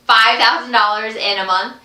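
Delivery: ordinary talk, nothing marked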